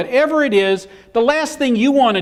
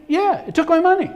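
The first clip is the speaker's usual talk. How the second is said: whiny complaint; 9 semitones higher than usual. Retold or imitated. Whining to the security guard.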